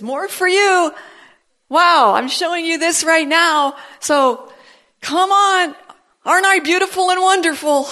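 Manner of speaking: high, loud, delighted voice of the Lord; joyful divine invitation; voicing the Lord. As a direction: play God beaming, showing off his gifts.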